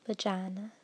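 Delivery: "vagina" with a flat Southern "ah" in place of "eye"; Southern belle accent. Shown as a demonstration. She reads the word list as a Southern belle, Southern accent. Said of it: "switching to my Southern Belle".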